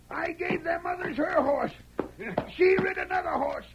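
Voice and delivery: tearful, shouted plea to be let go; frightened crying plea. Acted. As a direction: cry out in panic. Shaken man confesses, begging release.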